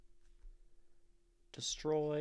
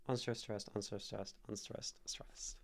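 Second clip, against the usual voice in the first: murmured metrical count of "unstressed, stressed"; iambic rhythm; 3 semitones lower, 8 dB quieter than usual. Shown as a demonstration. Teacher writing out the meter, soft rhythmic "unstressed, stressed". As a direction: run "unstressed, stressed" in quick even pairs, fading softer. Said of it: "It has, like, a bounce".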